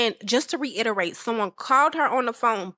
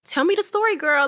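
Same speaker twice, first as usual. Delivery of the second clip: put-on high, eager tell me girl; eager gossip; 6 semitones higher than usual. Friend hearing crazy news, high eager gossip. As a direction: lean in hungry for the tea. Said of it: "And you like".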